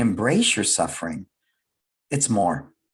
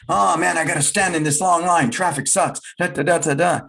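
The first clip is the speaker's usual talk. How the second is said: whiny griping voice, pitched up; mock complaining; 6 semitones higher than usual. Grumbler stuck in traffic, mock whining. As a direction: grumble like someone stuck in a long line. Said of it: "if everything is like".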